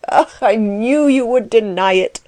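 exultant, grinning outburst; gloating cry. A narrator's reading. Klutz crows at Axel's denial, gloating cry. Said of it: "cried Klutz, slapping his leg and grinning horribly".

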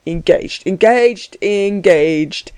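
swinging, drawn-out repetition, taunting lilt; lilting repeated word; sing-song. A narrator's reading. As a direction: chant it in a mild sing-song. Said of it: "repeated in a loud sing-song".

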